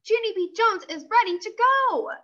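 high, eager announcement; excited announcing. Acted. Junie B. telling her parents, high excited announcement. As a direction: announce yourself, thrilled.

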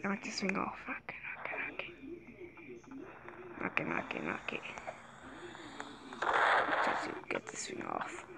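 hushed unvoiced mutter to herself; whispering. Real, not acted. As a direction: whisper under your breath, concentrating. Focused whispering during play.

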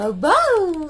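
put-on baby-talk bark. Shown as a demonstration. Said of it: "करके बताओ ना".

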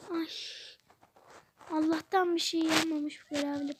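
ordinary talk

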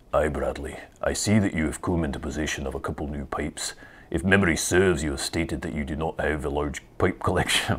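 low, soft attempt at a Scottish accent. Host reading a supporter's question on request, attempted Scottish accent. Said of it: "Scottish accent".

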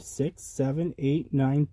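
ordinary delivery, nothing marked

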